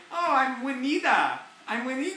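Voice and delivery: mock housekeeper greeting; imitating Juanita. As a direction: introduce yourself brightly. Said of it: "she's like".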